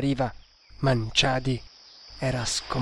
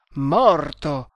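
"Morto!" cried out much louder in grief; sobbing cry; 7 dB louder than usual. A narrator's reading. Master grasping that his friend is dead, crying out. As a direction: burst out in despair. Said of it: "singhiozzò".

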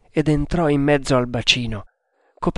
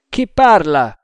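loud, higher shouted question; calling back; 4 semitones higher, 8 dB louder than usual. A narrator's reading. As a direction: shout back across the water. Calls back to unseen voice. (domanda gridata)

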